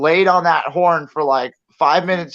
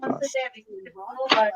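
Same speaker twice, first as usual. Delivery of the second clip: slow, shivering words; shivering from cold; 9 semitones higher, 9 dB quieter, much slower than usual. Real, not acted. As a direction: teeth chattering in the cold. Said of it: "It's fucking cold outside".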